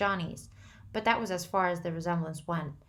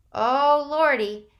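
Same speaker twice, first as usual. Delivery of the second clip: loud, choked cry; catch in voice; 8 semitones higher, 11 dB louder, much slower than usual. A narrator's reading. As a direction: near tears. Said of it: "a catch in Two-Bit's voice".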